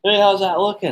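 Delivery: high, loud call from another room; girlfriend calling out. Retold or imitated. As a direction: imitate a girlfriend calling to check in. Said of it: "She calls".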